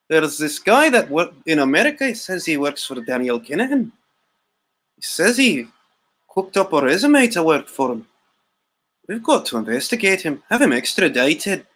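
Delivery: put-on Irish accent with a swooping lilt; mock Irish accent. Irish FBI agent reporting a wiretapped suspect, mock Irish accent. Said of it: "attempts at doing accents".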